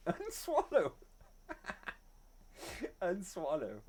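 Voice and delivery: slow, soft laughing repeat; drawn-out amused Unswallow. Real, not acted. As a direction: giggle out Unswallow slowly. Laughing repeat of chat word.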